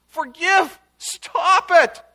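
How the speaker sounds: loud, high cry for mercy; pleading outburst, loud and high; bursting plea. Retold or imitated. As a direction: cry out desperately for the people. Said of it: "he bursts out in intercession".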